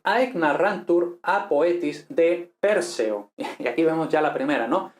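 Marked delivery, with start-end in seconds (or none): 0.0-3.3 reading Latin aloud. Shown as a demonstration.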